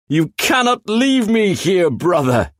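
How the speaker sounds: high, strained voice of a trapped demon begging; high desperate demon plea; pleading demon brother. Acted.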